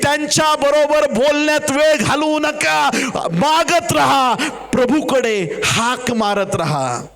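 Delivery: building to a high, sustained cry; impassioned preaching. Real, not acted. Preaches at mounting full force.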